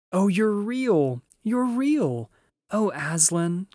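high, loud, rapturous cries; joyful cry. A narrator's reading. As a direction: cry out with overjoyed relief. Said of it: "cried Lucy".

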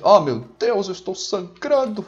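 hammed-up dying wail, rising high; melodramatic panic. Gamer playing a soldier who finds himself bleeding, melodramatic panic. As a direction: overact a horrified cry of agony.

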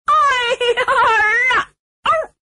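high wailing howl, suddenly cut off; choking theatrical wail; crying. A narrator's reading. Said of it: "就听见这人哭".

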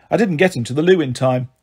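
usual speaking voice

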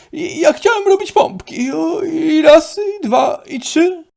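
high and loud, strained counting; excited excuse. Acted. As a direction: cover the fall with eager reps. Udaje, że robi pompki. (wysokie, głośne, napięte odliczanie)

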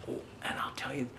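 quick secret whisper. Real, not acted. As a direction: let them in on a secret, breathy and fast. Secret aside to young listeners.

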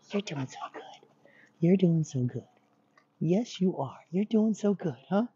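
high, sweet-talking princess voice for a dog. Shown as a demonstration. Trainer praising a dog on the job, princess voice. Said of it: "these extra princess voices that I'm doing".

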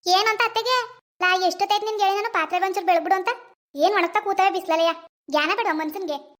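mother-in-law scolding the daughter-in-law for not washing the dishes. Acted.

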